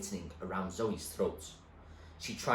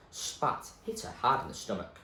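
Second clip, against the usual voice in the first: ordinary delivery, nothing marked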